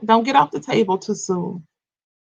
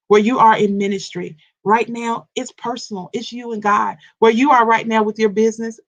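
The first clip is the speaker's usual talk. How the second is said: ordinary talk, nothing marked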